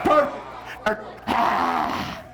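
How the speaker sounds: normal talking voice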